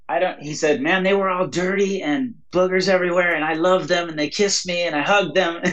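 put-on retelling a young man's words with a light, lifted voice; moved young man. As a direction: voice his joy lightly, a hint of awe.

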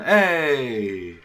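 drawn-out festive call; mock party whoop; party cheer. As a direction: imitate a reveller's "hey!". Imitating partygoers dancing, having fun.

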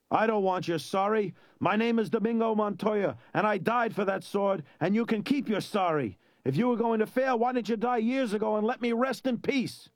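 high, insistent, indignant tirade; angry rebuke. A narrator's reading. Domingo Montoya rebukes his failing son, angry. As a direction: scold indignantly, pitched high all through.

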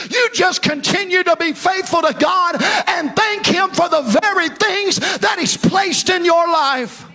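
loud, high, half-sung preaching; preaching at full force. Acted. Pastor urging his congregation to stay faithful, loud ringing preaching. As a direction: ring it out over the congregation like a revival.

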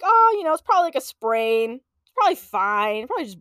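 high, loud, breezy mom voice; mock-confident brush-off; dismissive mom. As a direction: wave it off, imitating mom. Mom waving off the injury on phone.

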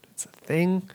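unvoiced breathy whisper. Real, not acted. Whispered aside.

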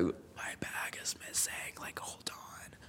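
hushed unvoiced whisper in a dark cinema; urgent theater whisper about a missing bag. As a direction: whisper it so the audience can't hear.

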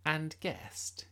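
put-on curious girl voice. A narrator's reading. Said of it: "she said".

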